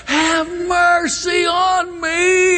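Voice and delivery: high, slow drawn-out cry; long pleading shout; desperate holler. Acted. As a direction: cry from the depths for mercy. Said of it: "and he hollers".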